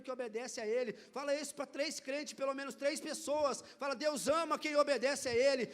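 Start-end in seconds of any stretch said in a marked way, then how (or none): 4.1-5.7 loud, firm declaration of faith; confident believer voice. Shown as a demonstration. Pastor urging the congregation to declare it boldly. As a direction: proclaim it like an obedient believer. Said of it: "E fala com voz de crente que obedece".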